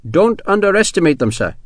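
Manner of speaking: grimly respectful warning. A narrator's reading. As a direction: caution a superior in earnest. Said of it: "McCandless said grimly".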